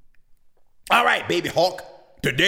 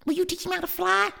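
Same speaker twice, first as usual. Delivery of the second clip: high, quick, soft plea; small high chick voice; timid little brother; 4 dB quieter, faster than usual. Acted. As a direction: ask hopefully and small. Baby hawk asks sister.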